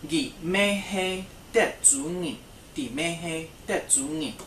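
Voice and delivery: very slow, careful model sentence; slow teacher demonstration; slow teaching model. Shown as a demonstration. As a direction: spell out each syllable for learners. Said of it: "She is from Texas too".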